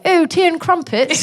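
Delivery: put-on high, drawn-out posh English accent; slow, hammed-up upper-class English, pitched high; exaggerated posh English. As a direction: play the caricature Brit abroad in a posh voice. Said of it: "Why am I?".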